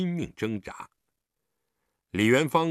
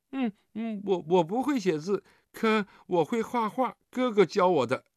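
put-on high, hesitant child voice; pitched-up kid, faltering at first; little child; 8 semitones higher than usual. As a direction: admit it like a small kid. Child character answers in story.

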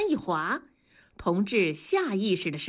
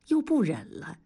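ordinary delivery

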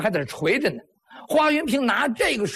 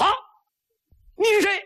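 startled shout; 11 semitones higher, faster than usual. Acted. Brother finds stranger behind curtain.

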